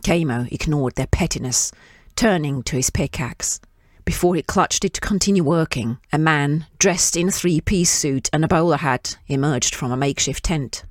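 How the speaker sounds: ordinary delivery, nothing marked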